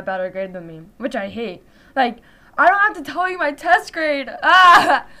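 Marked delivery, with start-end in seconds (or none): 4.4-5.1 a loud embarrassed laugh and a cry of "ah"; sudden laugh breaking out; burst of laughter. Real, not acted. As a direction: laugh it off, a little flustered. Embarrassed about her test grade.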